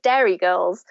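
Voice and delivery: put-on Derry Girls said the friend's way, not her own; friend's pronunciation. Author quoting her friend's odd pronunciation, imitated Derry Girls. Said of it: "my friend kept pronouncing it".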